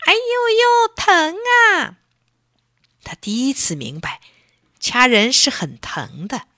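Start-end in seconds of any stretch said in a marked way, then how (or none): 0.1-2.0 very high, loud ouch shriek; shriek of pain. A narrator's reading. Elsie shrieking as the lobster pinches hard. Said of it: "疼得尖叫起来".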